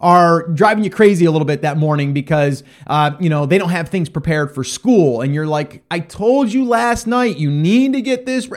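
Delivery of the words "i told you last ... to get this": high, slow scolding parent voice; a lightly played frustrated parent; exasperated parent. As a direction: nag the kids like a fed-up mom or dad.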